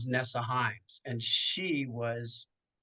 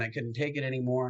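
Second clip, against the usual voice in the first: ordinary delivery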